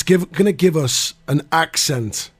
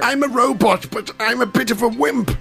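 higher, fussy robot whine; C-3PO voice; 7 semitones higher than usual. Pretend. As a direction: play a nervous robot butler. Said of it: "C three P O".

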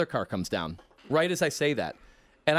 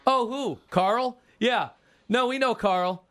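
knowing racketeers; 6 semitones higher, slower than usual. Acted. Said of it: "And they go".

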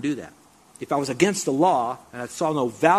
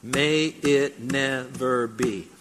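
slow emphatic recitation; much slower than usual. Shown as a demonstration. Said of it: "Say it with me out loud".

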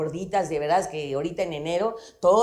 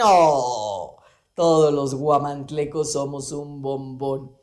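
lower, mock-defensive guamantleco voice; offended local pride; 7 semitones lower, slower than usual. Teacher voicing an offended guamantleco, mock defensive. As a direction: play a touchy local defending his town. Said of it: "Esto es tomármelo personal".

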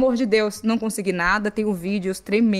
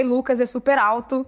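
usual speaking voice

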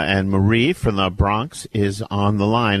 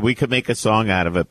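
ordinary talk

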